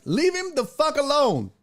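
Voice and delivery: retelling shouting down a bully; angry yell. Retold or imitated. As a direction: bark it like you're standing over him. Retold yelling at the bully.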